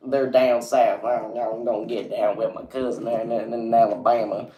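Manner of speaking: hammed-up Alabama accent; mock country Alabama accent; terrible Southern accent. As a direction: badly fake a Deep South twang. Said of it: "that was a terrible accent".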